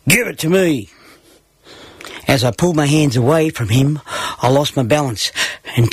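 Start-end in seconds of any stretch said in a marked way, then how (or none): 0.1-0.9 higher, demanding storyteller's yell; bossy brother, lightly yelled; mild mock yell. A narrator's reading. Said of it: "grabbed at my hands and yelled".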